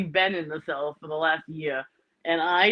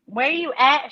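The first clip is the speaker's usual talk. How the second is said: mock Southern accent, pitched higher; Southern accent attempt; 6 semitones higher than usual. Teasing friend Sherry in a half-hearted Southern accent. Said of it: "I can't even do the Southern accent".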